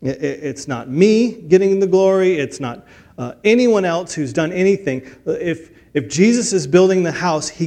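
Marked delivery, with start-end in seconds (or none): none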